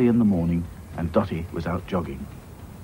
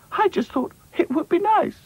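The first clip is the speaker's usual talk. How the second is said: upset princess. Acted.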